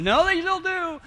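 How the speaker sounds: mock objection in a high voice; high-pitched mimicked protest; scowling teachers. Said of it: "teachers are looking at me, scowling".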